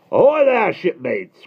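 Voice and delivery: high, loud sailor's call; old admiral hailing children; roaring hail. A narrator's reading. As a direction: bellow a greeting. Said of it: "cried a roaring, soaring voice".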